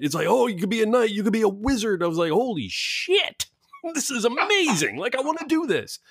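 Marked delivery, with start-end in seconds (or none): 0.3-2.0 put-on excited kid. Kid describes game to boy. 2.3-6.0 put-on amazed kid. Boy hearing about the game, high amazed awe.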